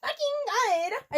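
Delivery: high, slow hammed-up squeal; mock scream. [name] hearing about the Good Charlotte concert, hammed-up squeal. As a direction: stretch it out like a fangirl losing it. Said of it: "I screamed".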